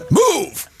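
high, loud one-word yell; shouting Roger Moore. Acted. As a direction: bark it like Bond in danger. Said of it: "Roger Moore when he shouts".

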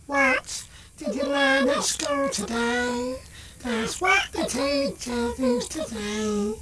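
sung through a heavy echo effect; soft sung line with echo. Shown as a demonstration. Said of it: "I was trying to put echo on".

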